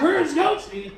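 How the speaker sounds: hollered question, retold; searching call-out. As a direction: call out for a missing friend. Friends calling out searching.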